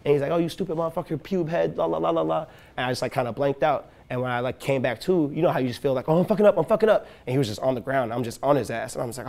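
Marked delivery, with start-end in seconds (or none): 0.3-2.5 higher-pitched jeering mimicry; mock a nagging bully, trailing into blah-blah; mocking the cook. Imitating the insulting cook. 6.1-7.1 high, louder mock panic; acted panic. Acts realizing he is messing up.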